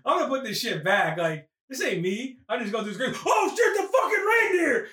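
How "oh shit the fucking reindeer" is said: high-pitched acted outburst of alarm; mock panicked exclamation, voice jumping up; alarmed cry. Comic retelling a movie scene as the reindeer appears, alarmed.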